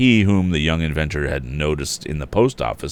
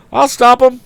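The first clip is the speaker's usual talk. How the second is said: high, loud firm promise; firmly declared; 6 dB louder than usual. A narrator's reading. Tom promising the woman, high firm declaration. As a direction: state it with resolve. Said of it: "declared the youth firmly".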